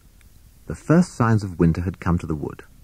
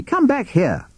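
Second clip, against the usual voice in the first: shouting; slower than usual. A narrator's reading. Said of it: "shouted Captain Beaky".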